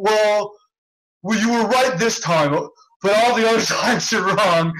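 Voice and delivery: mock a reluctant half-concession; grudging justification. Roommate justifies himself after losing the argument, grudging mock voice. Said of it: "he tried to justify it".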